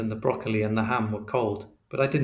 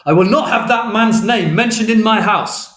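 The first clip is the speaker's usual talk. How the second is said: much higher, much louder roar; furious shout; 11 semitones higher, 12 dB louder than usual. Acted. Father shouting at his son over dinner, furious. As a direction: explode at him across the table. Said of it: "And then he shouted".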